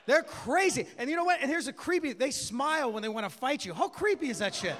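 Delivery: ordinary talk, nothing marked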